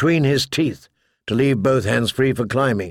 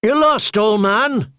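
high jeering spearman; mocking; 8 semitones higher than usual. A narrator's reading. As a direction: taunt the old man. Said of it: "mocked one of the spearmen".

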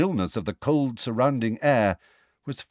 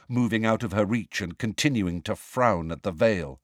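plain reading voice

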